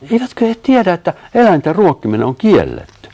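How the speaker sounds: high, loud, incredulous question; wondering. A narrator's reading. Sanni marvels at strangers feeding the animals, wondering aloud. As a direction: exclaim in high-pitched disbelief. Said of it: "Sanni ihmetteli".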